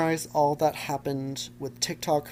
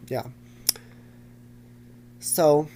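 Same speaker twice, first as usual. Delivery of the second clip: ordinary talk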